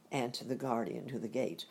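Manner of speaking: ordinary delivery, nothing marked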